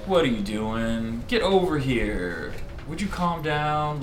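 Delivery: slow, drawn-out coaxing; unhurried, soothing command to a dog; calming a dog. Acted. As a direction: talk slowly to settle a nervous animal. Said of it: "I wanna say it to calm him".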